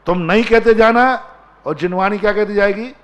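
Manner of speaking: high, loud rhetorical challenge; challenging question. Real, not acted. Preacher loudly challenging the congregation.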